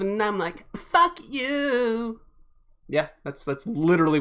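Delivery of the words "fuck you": lightly voiced punk sneer, drawn out; a hint of a snarling punk singer; punk singer imitation. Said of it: "That was actually an imitation".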